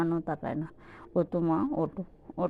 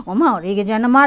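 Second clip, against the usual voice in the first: raised voice calling her to wake up; loud coaxing call; 8 dB louder, faster than usual. Real, not acted. Owner calling her sleeping pet loudly to wake.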